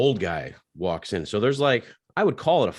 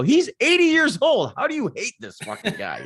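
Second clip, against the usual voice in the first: incredulous; 5 dB louder than usual. Retold or imitated. The speaker protesting to the regulars, squeaky and incredulous. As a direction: squeal it in mock disbelief. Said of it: "I go".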